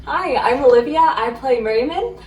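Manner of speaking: normal talking voice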